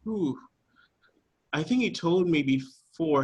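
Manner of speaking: ordinary delivery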